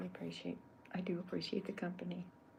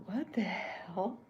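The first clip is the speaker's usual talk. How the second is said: slow, louder drawn-out What the hell; drawn-out startled what the hell; Chuck's line; 2 semitones higher, 6 dB louder, much slower than usual. Pretend. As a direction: try out the character's reaction. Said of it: "I'm gonna have Chuck going".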